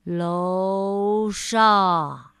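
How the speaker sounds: low, drawn-out drowsy answer; slow voice. Acted. Mr. Slow answering from upstairs, low and slow. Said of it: "一个缓慢的声音回答".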